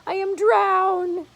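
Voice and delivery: high, slow, loud gasping cry; gasped. A narrator's reading. Grasshopper soaked by the storm, gasping high and loud. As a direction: gasp it out, half drowned. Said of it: "gasped the green grasshopper".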